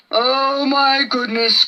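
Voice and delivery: slow, dismayed giant; scared groan. A narrator's reading. Brontosaurus hearing the tyrant's call, slow dismayed groan. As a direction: groan it out in dread. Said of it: "groaned Bron".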